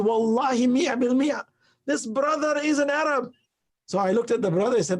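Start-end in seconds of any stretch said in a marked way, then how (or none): 0.1-3.2 excited insistence. Retold or imitated.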